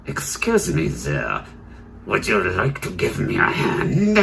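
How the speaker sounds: acted, put-on voice for a polite request; put-on surgeon voice. Surgeon asks a cleaner for help, put-on voice. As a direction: play the surgeon with an altered, theatrical voice. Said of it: "It would be more like".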